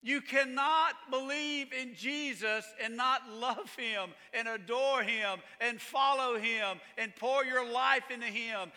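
slow, high, impassioned preaching; fervent pulpit build; preaching at full force. Real, not acted. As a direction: build it like a revival sermon.

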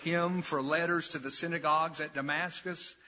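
measured, deliberate public Bible reading; scripture reading. Acted. Preacher reads Saul's conversion to his congregation, measured scripture reading. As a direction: read it out solemnly, pausing between phrases.

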